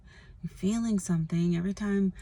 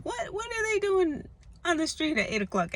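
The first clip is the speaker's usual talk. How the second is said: high mock outrage; 11 semitones higher than usual. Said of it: "major road rage".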